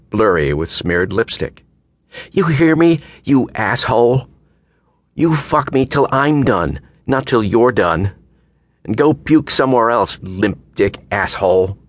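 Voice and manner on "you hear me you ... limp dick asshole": high, angry taunt of an acted woman; sneering. A narrator's reading. Drunk woman provoking the man she picked up, sneering. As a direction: spit the insults out in a raised, sneering pitch. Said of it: "features twisted in a sneer".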